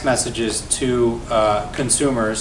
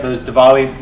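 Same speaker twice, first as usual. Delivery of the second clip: ordinary delivery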